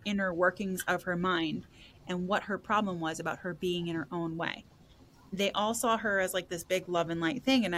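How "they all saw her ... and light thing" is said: ordinary delivery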